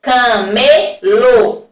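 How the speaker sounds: "Camelo" stretched out very slowly; very slow demo. Shown as a demonstration. Teacher stretching a word out for pupils. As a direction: drag "Camelo" out syllable by syllable. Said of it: "o som da última sílaba".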